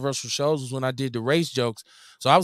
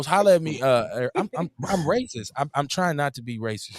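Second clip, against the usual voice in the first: normal talking voice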